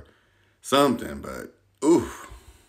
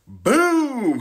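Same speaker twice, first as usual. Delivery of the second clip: loud, much higher burst through a laugh; laughing boom; 7 dB louder than usual. Real, not acted. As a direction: crack up as you say it. Laughs at the crash photo.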